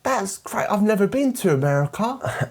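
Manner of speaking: high, hammed-up British girl. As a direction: introduce yourself in a high-pitched British caricature. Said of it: "a British girl who then goes".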